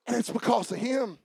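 ordinary talk, nothing marked

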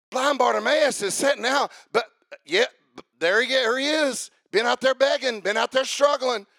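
high, driving revival-preacher shout; full-force preaching. Real, not acted. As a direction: build to a ringing, impassioned climax.

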